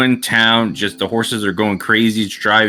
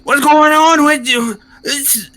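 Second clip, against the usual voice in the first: angry cart owner. Acted. Cart owner yells at the reckless wagon driver, high outraged voice. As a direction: scold him like a furious old merchant.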